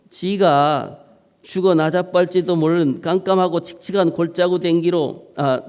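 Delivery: easygoing Chungcheong dialect, lightly voiced; Chungcheong dialect reading. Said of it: "그리고 충청도 버전".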